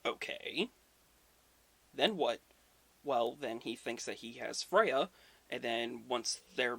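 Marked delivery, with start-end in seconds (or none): none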